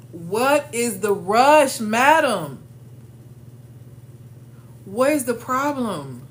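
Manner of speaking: slow it down to mock the rush; slow pointed question. Real, not acted. Scolding women rushing to doors.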